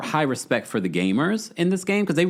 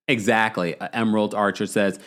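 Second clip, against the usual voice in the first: usual speaking voice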